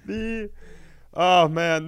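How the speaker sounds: ordinary delivery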